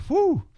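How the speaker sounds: high, loud exhaled whew; acted relief whew. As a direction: blow out exaggerated relief after a close call. Acted relief after getting through.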